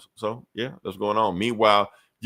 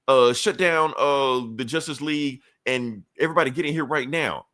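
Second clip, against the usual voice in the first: urgent order; 5 semitones higher than usual. Retold or imitated. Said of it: "Batman, like".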